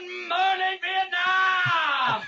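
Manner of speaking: high shouted radio greeting. Retold or imitated. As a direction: sing it out like a radio DJ. Repeats the famous radio greeting.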